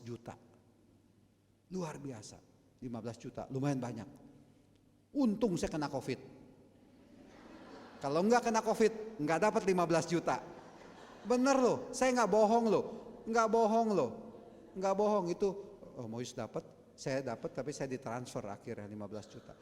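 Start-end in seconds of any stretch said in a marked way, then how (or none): 5.1-15.5 loud, high, emphatic exclaiming; shouting joyful testimony to the congregation; excited preaching. Acted. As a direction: insist loudly that it's true. Preacher exclaims loudly about money.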